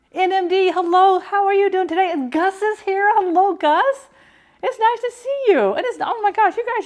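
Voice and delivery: high, loud, gushing hello to chat; excited greeting. Real, not acted. Streamer greeting her chat members excitedly, high and loud. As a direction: sing out a bright welcome to viewers.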